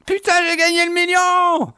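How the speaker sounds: high shriek of joy, laughing; shrieking jackpot winner. Real, not acted. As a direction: yell the win with real delight. Wins the million in the game.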